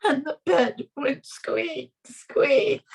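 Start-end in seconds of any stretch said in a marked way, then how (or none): none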